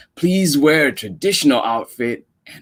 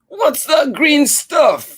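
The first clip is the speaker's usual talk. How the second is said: put-on high, slow sneering child; mocking kid voice; 5 dB louder, slower than usual. Storyteller voicing a classmate jeering at the lunchbox. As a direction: jeer at the new girl's lunch.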